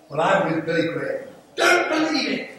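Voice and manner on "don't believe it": exclamation. Acted. Defiant quip about his own death.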